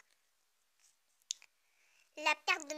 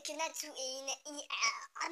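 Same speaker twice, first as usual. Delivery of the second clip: ordinary delivery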